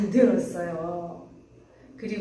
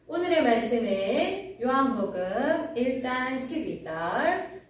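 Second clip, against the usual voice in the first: ordinary delivery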